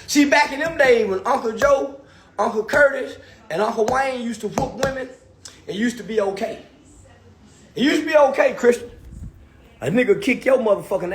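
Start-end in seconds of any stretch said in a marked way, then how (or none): none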